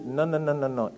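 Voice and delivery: ordinary talk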